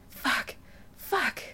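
soft breathy gasps, each word rising high then falling; breathless repeated gasps; gasped. A narrator's reading. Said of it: "Adam gasped".

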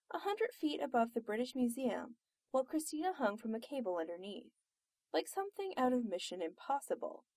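ordinary delivery

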